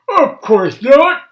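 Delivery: high, loud, slow denial; indignant Grandpa. Acted. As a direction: huff it as an offended old man. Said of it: "replied Grandpa".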